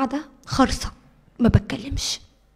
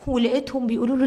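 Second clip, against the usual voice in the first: usual speaking voice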